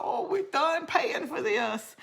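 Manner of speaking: high, drawn-out mock rejoicing; retold joy in a high, stretched voice; happy relief. Parents recalling happy tears at graduation, high mock relief. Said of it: "maybe it was happy tears".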